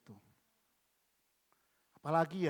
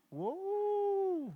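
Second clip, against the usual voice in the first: much higher long drawn wow; exaggerated wow. Real, not acted. Preacher reacting to the boss's affair, high drawn-out wow. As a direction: stretch the wow in mock shock.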